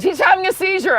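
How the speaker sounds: high-pitched mock panic; alarmed lady. Lady tells cabin he's seizing, high acted alarm. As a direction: imitate a woman raising the alarm. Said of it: "The lady says".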